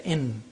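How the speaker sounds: "in" ending in a clear n; n from teeth ridge. Shown as a demonstration. Teacher showing where N is made, "in" with a clear teeth-ridge n. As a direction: say "in", tongue on the ridge behind the teeth. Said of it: "pronounced from behind the teeth ridge".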